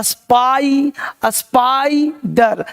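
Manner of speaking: स्पाई drawn out slowly, then स्पाइडर in parts; teacher's slow स्पाइडर with a long "eye"; broken into syllables. Shown as a demonstration. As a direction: spell out the sound slowly: स्पाई, then स्पाइडर as "spaaai... dar". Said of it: "आई पढ़ते हो ना".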